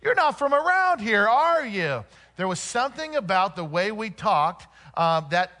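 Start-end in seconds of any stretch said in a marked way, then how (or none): 0.0-2.0 higher, louder curious local voice; imitating a curious local, lightly; Portland locals asking. Locals to the Oklahoma newcomers, a light mock curious voice. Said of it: "they would say things like this".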